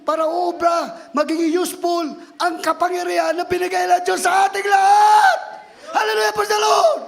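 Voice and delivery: climbing to a loud high shout; preaching at full force. Acted. Preacher driving the point home, shouting. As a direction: build the sermon to a roar.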